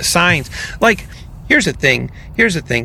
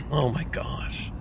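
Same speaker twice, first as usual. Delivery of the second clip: ordinary talk, nothing marked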